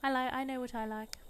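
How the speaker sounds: put-on a light English accent, prim and firm; mock English accent. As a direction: play the Sim snootily in a posh English accent. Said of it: "I don't know why she's English now".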